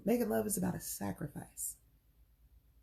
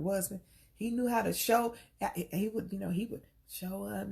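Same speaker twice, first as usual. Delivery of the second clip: normal talking voice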